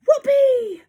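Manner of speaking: very high, loud squeal of delight; witches' cry. A narrator's reading. Witches cheering the plan, squealed whoop. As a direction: whoop it at the top of your voice. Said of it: "cried the witches".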